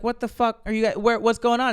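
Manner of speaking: high, indignant what-the-hell; exasperated outburst. Retold or imitated. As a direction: demand answers from lazy buddies. Angry at resting unit.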